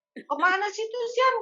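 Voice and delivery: imitating Mama. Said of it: "Kita bilang gitu".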